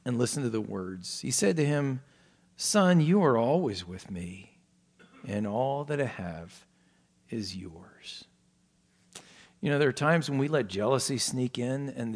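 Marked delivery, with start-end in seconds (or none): 2.6-8.3 gentle reassurance. Retold or imitated. Father gently answers his bitter son.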